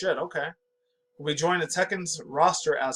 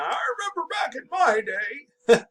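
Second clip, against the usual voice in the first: put-on old man voice; 11 semitones higher, slower than usual. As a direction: shout it like a grumpy old-timer. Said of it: "sound like an old man".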